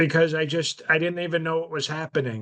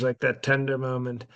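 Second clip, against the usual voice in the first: normal talking voice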